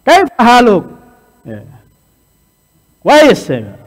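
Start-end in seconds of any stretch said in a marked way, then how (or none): 0.0-0.9 high and loud greeting question. Shown as a demonstration. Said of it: "nadanya tinggi". 3.0-3.5 high and loud greeting question. Shown as a demonstration. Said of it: "nadanya tinggi".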